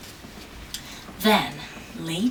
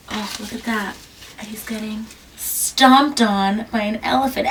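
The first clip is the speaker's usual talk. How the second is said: usual speaking voice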